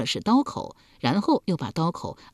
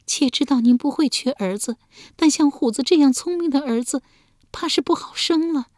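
meek plea; 10 semitones higher, 3 dB louder than usual. A narrator's reading. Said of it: "诺声说道".

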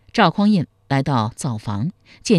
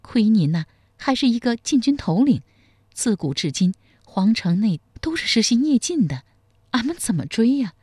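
put-on higher, wry old wife's voice with a bitter smile; 7 semitones higher than usual. A narrator's reading. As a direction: tease him with a rueful laugh in the voice. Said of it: "杜四娘苦笑一声说".

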